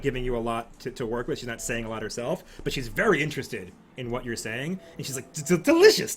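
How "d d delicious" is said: high, stammering delight; gushing noblewoman; excited. Acted. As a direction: savour the word, thrilled. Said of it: "she's super excited about the idea".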